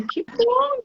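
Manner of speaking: very high, soft and ironic; sweetly ironic "que bom", pitched high; mock pleased. Teacher answers student's boast, very high and ironic. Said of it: "Eu falei".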